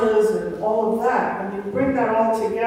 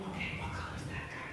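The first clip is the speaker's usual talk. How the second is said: hushed whisper, almost breath only; whispering to her little boy; whispered question; 17 dB quieter, faster than usual. Retold or imitated. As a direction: whisper it like asking a secret. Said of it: "I started saying".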